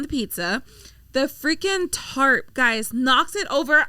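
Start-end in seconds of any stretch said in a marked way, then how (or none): none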